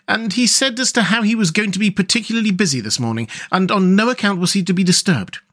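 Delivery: put-on respectful young hedgehog. Hedgehog passing on the master's orders, high and respectful.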